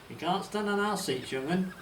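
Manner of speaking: put-on a guard teasing a boy in West Country burr; West Country accent. Guard on the train scolds boy, West Country accent. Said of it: "said a voice with a strong West Country accent".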